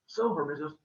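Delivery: ordinary delivery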